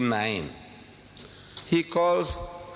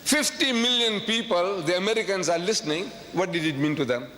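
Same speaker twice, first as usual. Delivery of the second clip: ordinary talk, nothing marked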